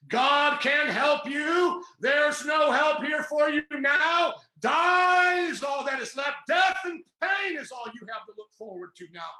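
preaching at full force. Real, not acted. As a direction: cry out the devil's call in fiery anguish. Voicing the devil's altar call.

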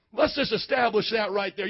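usual speaking voice

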